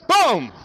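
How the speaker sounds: a loud high mouthed rifle blast; gunshot boom. Retold or imitated. Pastor imitating his rifle shot to the congregation, loud boom. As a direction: blast it out like a gun going off.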